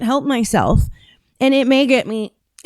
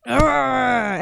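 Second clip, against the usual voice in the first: long exasperated groan; aggravated "urrgh"; frustrated growl; 2 semitones higher than usual. Real, not acted. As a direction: growl out your irritation, drawn out. Exasperated at imitated speaker.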